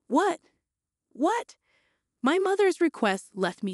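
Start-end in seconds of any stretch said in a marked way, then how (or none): 0.1-1.6 rising, incredulous what; shocked what. A narrator's reading. Daughter hearing mom's money request, shocked disbelief.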